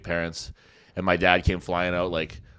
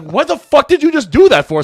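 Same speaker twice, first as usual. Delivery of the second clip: loud, slow, furious shout at the friend; angry yell; 13 dB louder than usual. Retold or imitated. Angry friend yelling about the egg on the window. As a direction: bellow it out, outraged. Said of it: "So he was like".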